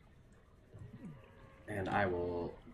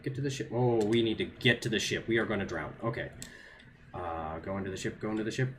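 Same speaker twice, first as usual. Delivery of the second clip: normal talking voice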